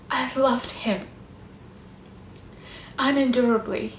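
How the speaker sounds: ordinary talk, nothing marked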